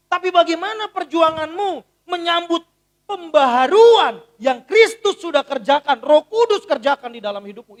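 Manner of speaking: booming sermon call; full force preaching. Real, not acted. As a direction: drive it home from the pulpit.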